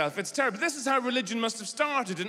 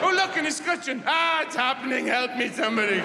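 mock horrified cry; 5 semitones higher than usual. A man discovering he likes architecture, hammed-up horror.

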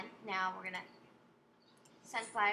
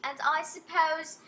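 Matilda; 3 semitones higher, 4 dB louder than usual. Acted. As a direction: play the game lady in amateur British. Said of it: "I'm trying to go British".

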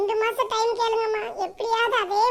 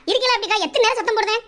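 ordinary delivery

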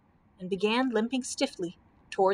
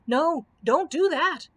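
loud, slow raised protest; roaring; 6 semitones higher, 5 dB louder, slower than usual. A narrator's reading. Cowardly Lion stops Scarecrow chopping, roaring protest. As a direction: roar it out in alarm, drawn out. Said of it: "roared the Cowardly Lion".

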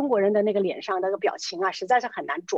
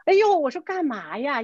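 startled dismay; 6 semitones higher, 3 dB louder than usual. Retold or imitated. Reacting to wife's alarming accusation.

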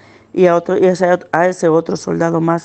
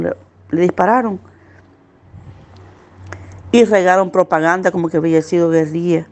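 usual speaking voice

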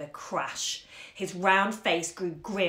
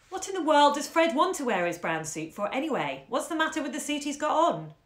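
higher, pushy questioning; impatient; 7 semitones higher, 3 dB louder than usual. Acted. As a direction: demand impatiently. Impatient mother.

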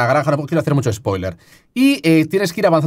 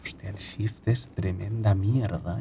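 breathy much softer whisper; 7 semitones lower, 13 dB quieter than usual. Real, not acted. Hiding from the game's mic, whispering. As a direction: breathe the words to stay unheard.

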